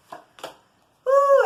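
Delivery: high, loud woo; excited whoop. Real, not acted. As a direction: yelp with delight. Reacting to next card.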